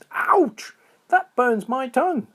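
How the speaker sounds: very high, loud pained squeak; high little critter crying ouch; squeaky squirrel yelp. A narrator's reading. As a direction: yelp as the drink burns. Burning tongue on fire water.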